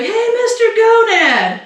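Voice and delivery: high, drawn-out heckling call; mock schoolboy shout; imitated yell. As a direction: holler it across a gym, stretching the name. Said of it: "he was, like, yelled out".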